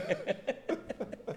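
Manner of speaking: unvoiced breathy laugh. Real, not acted. Laughing at the story.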